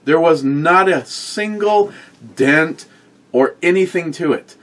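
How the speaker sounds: high, animated and emphatic; excited. Real, not acted. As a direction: build it up with disbelief. Animated rant about the box.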